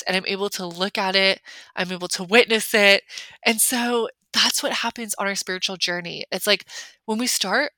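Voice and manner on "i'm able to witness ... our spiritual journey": normal talking voice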